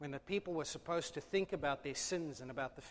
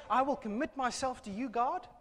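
mock vow; 8 semitones higher, 6 dB louder than usual. Mocking a hollow vow.

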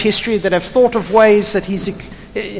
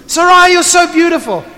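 louder and higher, hammed-up flirting; exaggerated flirt; 8 semitones higher, 5 dB louder than usual. Abraham showering Sarai with compliments, hammed-up loud flirt. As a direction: overplay the flattery, calling out. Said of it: "It's not just one long".

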